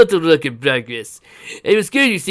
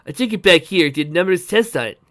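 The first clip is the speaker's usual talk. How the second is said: loud, energetic inventor's explanation; raised, forceful, triumphant character voice; exulting; 4 semitones higher, 8 dB louder than usual. A narrator's reading. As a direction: boast excitedly about the discovery. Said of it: "he exulted".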